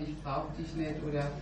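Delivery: ordinary talk, nothing marked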